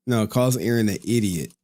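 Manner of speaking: usual speaking voice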